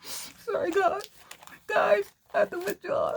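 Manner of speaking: high, wailing fake sob; mock crying. Vlogger imagines crying over Holly's gift, hammed-up sobbing. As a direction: ham up a tearful breakdown. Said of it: "I'll be going like".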